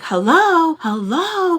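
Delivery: loud, high, drawn-out hello; indignant hello. Retold or imitated. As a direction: call him out, incredulous. Repeats her indignant calling out.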